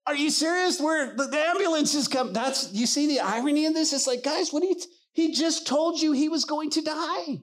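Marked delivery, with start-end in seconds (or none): none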